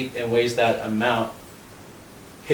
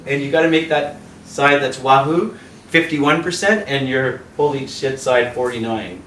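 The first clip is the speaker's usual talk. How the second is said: ordinary talk, nothing marked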